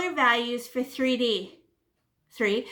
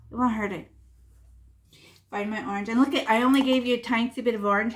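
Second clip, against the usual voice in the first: ordinary talk, nothing marked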